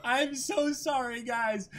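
normal talking voice

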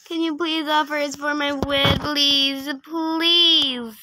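pleading. Real, not acted. Player begging a trader to offer for the video, pleading. Repeats the request aloud, begging.

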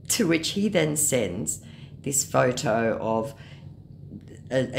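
ordinary talk, nothing marked

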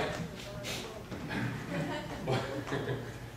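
a soundless huff of laughter; breathless silent laugh. Real, not acted. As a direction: laugh almost without voice. Laughing mid story.

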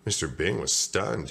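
ordinary delivery

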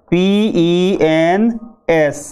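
"पी ई एन एस" spelled letter by letter, slowly; slow spelling. Shown as a demonstration. Teacher spells out the word pens for students. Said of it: "जैसे".

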